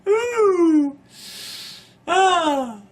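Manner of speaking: high breathless laughter; laughing. Real, not acted. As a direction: catch your breath laughing. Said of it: "without laughing".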